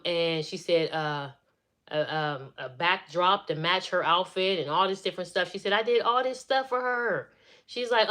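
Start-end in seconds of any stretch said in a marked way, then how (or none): 5.7-7.3 higher-pitched, aggrieved imitation; mock upset, pitch raised; upset protest. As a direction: retell the sister's complaint. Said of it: "She was so upset about that".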